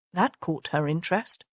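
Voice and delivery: ordinary delivery, nothing marked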